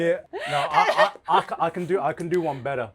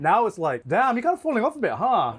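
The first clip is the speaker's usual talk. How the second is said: mock nagging parent; 10 semitones higher, 6 dB louder than usual. Mocking parent voice.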